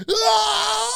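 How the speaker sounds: high mock martial-arts scream; imitating a slow-mo action hero's cry; movie fight yell. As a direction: let out a hammed-up kung fu yell. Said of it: "he's going".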